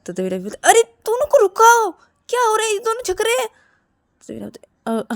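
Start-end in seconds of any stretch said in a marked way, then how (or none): 0.7-3.5 very high, loud cry for intervention; alarmed shout. Acted. Ravi asks Rahul to stop the fighting, alarmed shout. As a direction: call out urgently to stop them.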